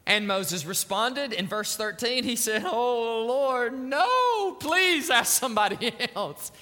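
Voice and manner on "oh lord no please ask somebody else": high, stretched-out mock begging; hammed-up pleading. Moses begging God to send somebody else, hammed-up pleading. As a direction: beg out of the job, wailing comically on "no".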